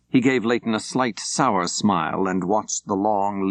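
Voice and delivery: plain reading voice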